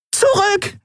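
very high panicky cry; panicked scream. A narrator's reading. Scout fleeing pursuers warns her friends, panicked scream. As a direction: cry out a frantic warning. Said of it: "schrie sie panisch".

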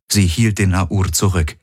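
ordinary delivery

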